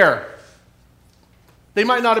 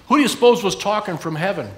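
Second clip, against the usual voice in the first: usual speaking voice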